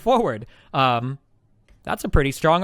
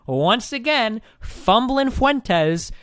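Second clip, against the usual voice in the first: high, slow drawn-out nickname taunt; mocking taunt; 7 semitones higher, much slower than usual. Real, not acted. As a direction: savor each mocking nickname.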